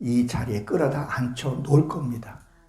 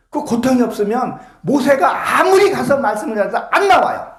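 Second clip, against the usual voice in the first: loud, higher fervent shout; preaching shout; 6 semitones higher, 4 dB louder than usual. Real, not acted. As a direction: build to a preacher's cry. Preacher climaxes. (설교 절정에서 외치듯)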